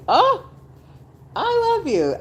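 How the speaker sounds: high, loud gush of affection; gushing love. Retold or imitated. Imitating the women gushing over her, high and loud. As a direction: gush it out adoringly. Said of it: "And it's like".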